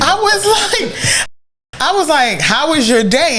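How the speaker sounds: laughing. Retold or imitated. Retelling husband's greeting, laughing.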